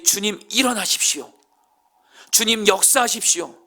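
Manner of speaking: high, loud fervent plea; earnest prayer. Acted. David suddenly praying to God, fervent loud plea. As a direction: cry out to God with urgency. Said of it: "간절하게 기도하기 시작하는".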